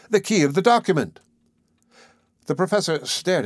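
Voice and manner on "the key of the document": high, insistent exclamation; eager narrated cry. A narrator's reading. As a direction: press the point in a raised pitch. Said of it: "I cried".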